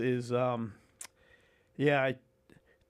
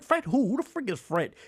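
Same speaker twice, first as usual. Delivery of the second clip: confused demand; 8 semitones higher than usual. Retold or imitated. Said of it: "I'm like".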